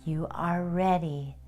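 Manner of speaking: soft, hushed hypnotic countdown, lingering pauses; guided meditation. Acted. Guide counting listeners down from seven to one, soothing meditation voice.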